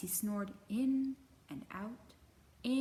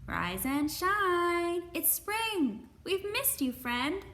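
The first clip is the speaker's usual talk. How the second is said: much higher, slow sing-song hare; cheery hare; 9 semitones higher, 6 dB louder, slower than usual. Acted. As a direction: bounce it up like an eager bunny. Hare greets sleeping bear.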